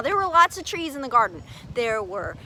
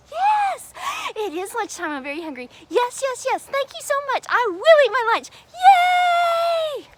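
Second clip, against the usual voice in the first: high, happy eager yes; excited Eve accepting lunch; 9 semitones higher than usual. Acted. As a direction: bounce with hunger.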